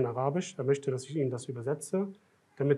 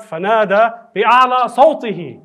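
higher, louder, slow Arabic recitation; loud recited call; 6 semitones higher, 14 dB louder, slower than usual. Retold or imitated. Preacher reciting the narration's cry to prove he shouted, loud slow call. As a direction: chant the Arabic line out, slowly and loudly. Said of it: "hat mit seiner lautesten Stimme gerufen".

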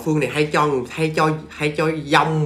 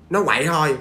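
ordinary talk, nothing marked

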